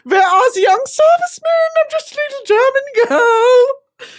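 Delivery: hammed-up damsel looking for the boys; mock German girl. Asking guards for young servicemen, high hammed-up girly call. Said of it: "And I'm gonna say".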